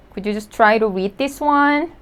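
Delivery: ordinary talk, nothing marked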